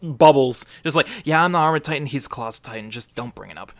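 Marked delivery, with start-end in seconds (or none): 1.2-3.7 casual aside fading to very soft and low; tiny throwaway confession, dying away; background aside. Retold or imitated. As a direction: toss it off, trailing down to a near-inaudible murmur. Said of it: "it's tiny little, uh, bubbles".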